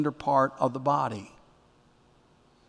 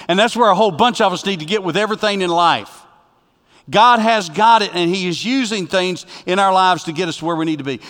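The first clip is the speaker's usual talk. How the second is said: raised, loud pulpit preaching with high pitch; fiery preaching; 5 semitones higher, 9 dB louder than usual. Acted. Pastor urging the congregation to trust God, preaching loud and high. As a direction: preach it out with full conviction to the whole room.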